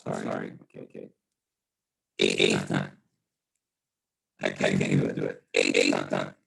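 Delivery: muffled, distorted Zoom audio, low and dull; muffled mic. Real, not acted. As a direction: sound as if through a broken microphone. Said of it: "I'm coming in muffled".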